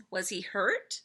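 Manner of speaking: ordinary delivery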